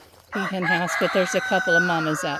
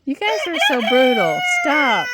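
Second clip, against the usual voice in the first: raised, higher voice breaking up a fight; scolding shout; 7 semitones higher, 8 dB louder than usual. Real, not acted. Owner scolding roosters fighting, loud and sharp. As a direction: call out sharply to stop them.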